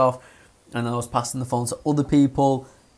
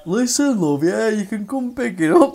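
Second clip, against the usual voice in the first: higher, louder cheeky bloke voice; higher mock friendly voice; cheeky coworker; 9 semitones higher, 6 dB louder than usual. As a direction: tease the caller as a jokey mate. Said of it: "he's like".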